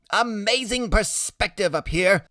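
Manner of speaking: high, slow, wondering voice; excited kid on high; marvelling boy. Acted. Freak riding high, marvelling.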